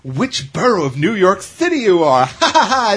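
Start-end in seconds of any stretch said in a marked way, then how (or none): 2.2-2.9 real burst of laughter; laughing. Real, not acted. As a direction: laugh it off, amused. Laughing at silly quiz.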